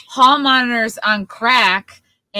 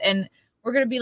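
ordinary talk

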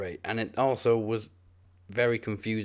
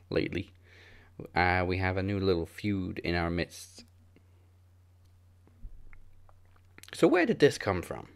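promo announcer voice; announcer read. Acted.

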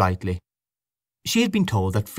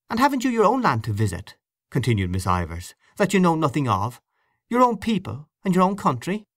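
raised, insistent questioning; challenging cross-examination; 8 semitones higher than usual. A narrator's reading. Miss Ivors grilling Gabriel before the neighbours, raised and insistent. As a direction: corner him with every question.

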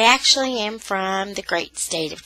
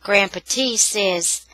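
plain reading voice

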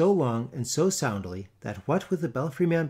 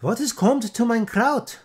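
put-on much higher, loud Dutch-accented shriek; screaming wives; 10 semitones higher, 7 dB louder than usual. A narrator's reading. Wives screaming over the kraut, high Dutch accent. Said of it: "screamed all the vrouws".

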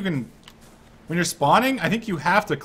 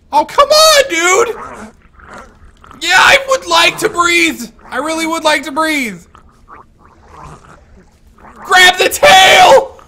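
very high, very loud desperate yelling; panicked screaming; 12 dB louder, slower than usual. Real, not acted. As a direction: scream for help, drawn out and slow. Drowning, screaming at teammates.

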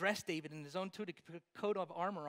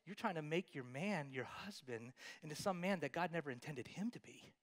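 whisper; 5 semitones lower, 6 dB quieter than usual. Real, not acted. Preacher drops to whisper.

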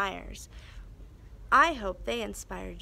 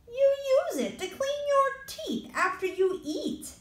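put-on Ian the boy. Ian voiced high throughout.

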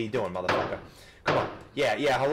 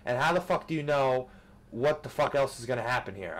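ordinary talk, nothing marked

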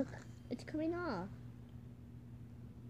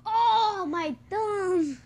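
pained cry; 7 semitones higher, 7 dB louder, much slower than usual. Real, not acted. Hurt thumb.

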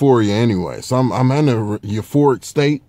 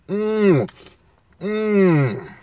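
high savoring mmm; 9 semitones higher than usual. Real, not acted. Enjoying pizza.

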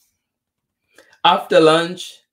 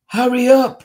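high, loud urgent call; mother crying out; 13 semitones higher, 13 dB louder than usual. A narrator's reading. Mommy rushing Tisha for school, high urgent cry. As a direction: cry out like a hurrying mom. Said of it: "cried Mommy".

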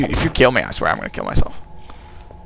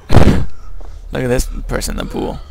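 ordinary delivery

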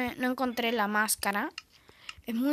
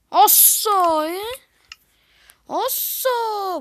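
loud startled shout; yelling; 8 semitones higher, 8 dB louder, much slower than usual. Real, not acted. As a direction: shout a warning at the bear. Spots a bear, yells.

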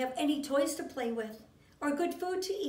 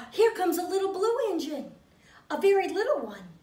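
higher and louder, bursting with hope; excited announcement; 4 semitones higher, 6 dB louder than usual. Acted. Clown spots a little blue engine, calling out louder. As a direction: call out the good news.

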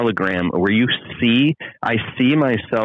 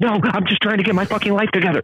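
much higher, frantic protest; exasperated pleading; 13 semitones higher than usual. Retold or imitated. [name] answering his family, frantic and exasperated. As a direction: cry out defending yourself. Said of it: "I'm like".

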